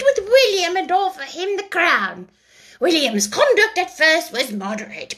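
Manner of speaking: high, hurried lecture; hurried mouse. A narrator's reading. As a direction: rush on, ignoring the question. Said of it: "but hurriedly went on".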